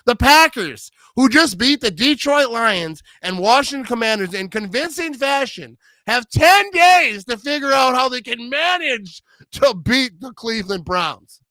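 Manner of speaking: slow, booming hype-man voice, stretching "ten days"; mock hype announcer. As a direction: sell it like a big-game broadcast intro. Mock announcer hyping the Packers.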